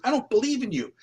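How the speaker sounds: high-pitched retold protest; acted disbelief. Atheist detective to psychic, high-pitched incredulous protest.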